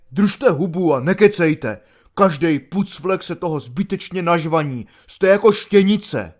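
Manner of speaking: loud coarse scolding, rising to a bark at the end; gruff rebuke. Acted. Corporal showing he is boss snaps rudely at Švejk, loud. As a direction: corporal asserting command, barks at prisoners, loud and rude. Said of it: "a proto řekl hrubě".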